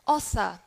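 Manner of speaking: "Author" with the final R left silent; British non-rhotic. Shown as a demonstration. Teacher repeats author for class, British, no R. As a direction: end "Author" on a plain "uh". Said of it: "in British accent, we would say".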